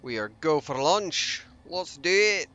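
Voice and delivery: loud, high-pitched mock Scots; poor Scottish accent. Gamer playfully calls the last jump home, poor Scottish accent. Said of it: "that's the Scots. Sorry, Clyde. That's my poor accent skills".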